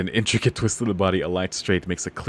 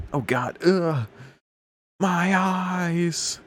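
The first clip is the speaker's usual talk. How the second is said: much higher, hammed-up pained yelp; mock pain; 10 semitones higher, much slower than usual. Hero poked in the eye by his sister, hammed-up yelp. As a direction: whine like you've just been poked in the eye.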